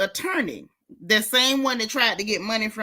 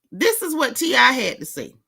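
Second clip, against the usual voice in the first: ordinary delivery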